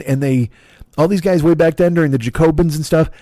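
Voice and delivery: ordinary talk, nothing marked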